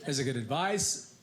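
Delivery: usual speaking voice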